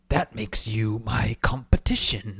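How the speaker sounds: imitating a menacing whisper; mock-sinister stage whisper; whispered quote. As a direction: hiss it out in a hoarse whisper. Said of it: "He says".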